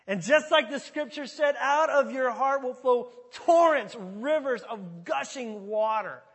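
high, slow, full-force shout; crying out loud. Acted. Preacher as Jesus calling to the festival crowd, full-voiced shout. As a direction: proclaim it at the top of your lungs. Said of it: "He's at the top of his lungs, cries out".

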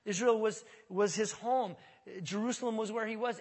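usual speaking voice

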